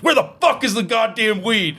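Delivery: high, hammed-up furious yell of a dad; mock angry dad. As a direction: play the angry dad barging in. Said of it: "he kicked the fucking door and he goes".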